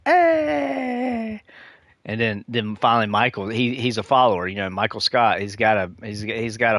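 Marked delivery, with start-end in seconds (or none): 0.1-1.4 high sing-song hey; Dwight joining in, belted; mock Dwight hey. Retelling The Office, Dwight's high sing-song hey. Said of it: "he's like".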